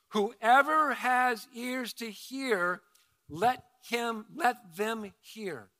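each word of "Whoever has ears to hear" drawn out slowly; slow proclaimed call. Retold or imitated. Preacher voicing Jesus calling out to the crowd, slowly. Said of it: "he raises his voice and calls out".

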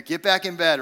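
tired dad laying down the rule; stern parent command. Retold or imitated. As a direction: send the kids back to bed, no arguing. Parent scolding rowdy kids.

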